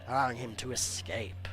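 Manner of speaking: hammed-up trailer narration, slowing for effect; dramatic bio read. Streamer reading a game hero's backstory, in mock trailer style. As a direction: read it like a movie trailer voice-over.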